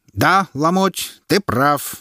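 high, slow mocking agreement; herald's exclamation. A narrator's reading. Herald answers the heckler, slow and high. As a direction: savour it, playing to the crowd. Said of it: "воскликнул Дубль".

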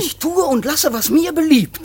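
high and loud, defiant; defiant shout. Acted. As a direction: fling it at her defiantly. Son defies mother.

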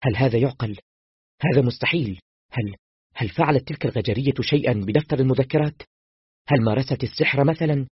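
panicked. Acted. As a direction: fire out questions in rising panic. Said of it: "فقلت بذعر".

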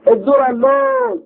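long high sung-out call; arabic street vendor cry. Acted. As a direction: call out your wares down the street. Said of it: "مناديا".

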